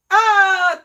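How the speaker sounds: high, loud excited exclamation; delighted cry. Real, not acted. Host crying out happily as a friend joins the live. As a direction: yelp with joy at the arrival. A viewer arrives.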